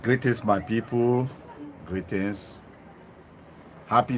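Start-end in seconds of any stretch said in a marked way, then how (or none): none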